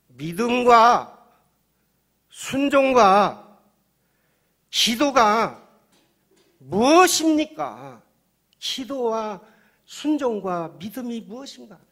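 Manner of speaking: high, booming words held long and set apart; full-force preaching. Real, not acted. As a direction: thunder the question at the congregation.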